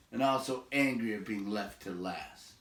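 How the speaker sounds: plain reading voice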